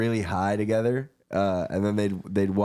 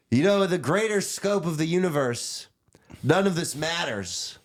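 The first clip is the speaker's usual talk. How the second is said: high, slow, spaced-out cosmic musing; lofty, dreamy rant pitched well above his own voice; Michael's speech voice; 8 semitones higher than usual. Retold or imitated. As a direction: drift up high and slow like a stoned philosopher. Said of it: "he talked like this".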